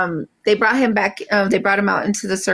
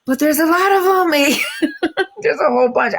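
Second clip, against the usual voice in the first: mock overwhelmed protest; 8 semitones higher than usual. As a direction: whine it up high at mom, half joking. Repeating her overwhelmed reply to mom.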